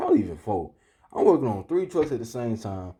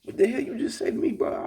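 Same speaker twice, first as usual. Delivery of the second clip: high, quick, affronted question; retold indignant disbelief. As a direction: act out outraged shock, pitch way up. Retold outrage at manager's remark.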